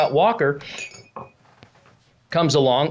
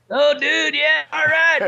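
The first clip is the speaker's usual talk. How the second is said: high, excited slacker shout; couch potato character; 9 semitones higher, slower than usual. Acted. Ventriloquist voicing his couch potato puppet, high excited dude voice. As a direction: cheer high as a goofy dude. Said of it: "he's a couch potato".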